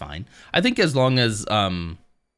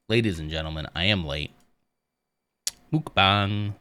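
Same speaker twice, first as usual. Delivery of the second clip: usual speaking voice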